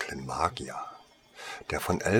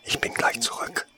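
a voiceless whisper. Acted. Saleswoman whispering to the hidden boy before leaving. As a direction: breathe it so no one in the shop hears. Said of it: "flüsterte sie ihm zu".

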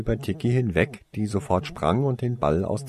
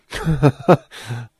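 louder, higher burst of laughter; laughing; 5 semitones higher, 8 dB louder than usual. Acted. Toriesa laughs at the question about the water. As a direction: laugh it off brightly. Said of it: "lachte Toriesa".

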